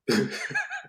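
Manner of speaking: a quick high burst of laughter at himself; short rueful laugh. Real, not acted. Laughing at himself after admitting the feds caught him.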